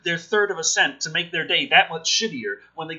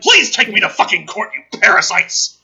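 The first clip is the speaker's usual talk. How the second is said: loud, indignant challenge; exasperated shout at the copyright trolls; angry dare; 3 semitones higher, 8 dB louder than usual. Retold or imitated. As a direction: spit out a furious taunt. Mock challenge to claim companies.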